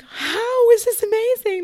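high, slow disbelieving wail; high slow incredulous wail. Retold or imitated. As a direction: stretch it out in disbelief. Overwhelmed reply to the midwife.

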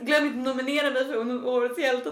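mock self-important appeal; hubristic babbling. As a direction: teasingly mimic a self-proclaimed hero seeking nominations. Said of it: "din hybris var det sjukaste".

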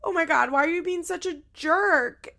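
mock outraged yell, high and loud; retold scream. Imitating a daughter screaming at her dad, mock yell. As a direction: imitate an upset daughter screaming, half in earnest. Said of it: "she starts screaming".

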